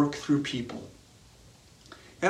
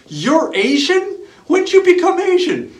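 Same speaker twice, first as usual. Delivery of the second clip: mock outburst of shock at the spouse's news; astonished spouse; 13 semitones higher, 9 dB louder than usual. Acts astonished spouse in imagined dialogue.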